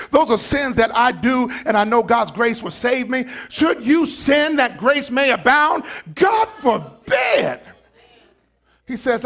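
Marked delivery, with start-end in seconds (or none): 0.1-7.6 loud, high shouting sermon; full-force preaching. Acted. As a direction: preach it hard to the congregation.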